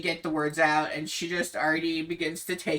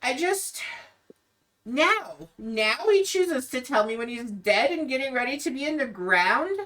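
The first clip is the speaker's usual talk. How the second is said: higher, rising distress; Irina, bitter; 6 semitones higher than usual. Acted. Irina rails to Ismark about her father's secret. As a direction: let the anger at her dead father break through. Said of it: "she goes, she continues".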